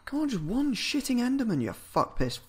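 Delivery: frustrated rant rising high at first; angry outburst. Real, not acted. Gamer rages at the game over no Endermen. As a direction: snap at the missing mob in frustration. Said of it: "Sorry, that was angry".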